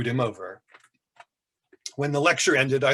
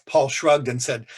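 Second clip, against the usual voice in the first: ordinary delivery, nothing marked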